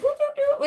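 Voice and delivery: very high whooping calls. Retold or imitated. A man two-stepping, whooping very high. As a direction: whoop high like a dancing cowboy. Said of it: "he's just like".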